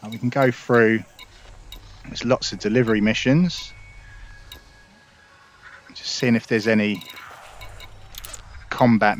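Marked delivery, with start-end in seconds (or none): none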